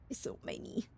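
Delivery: breathy whispered aside. Shown as a demonstration. Streamer whispering to chat, hushed whisper.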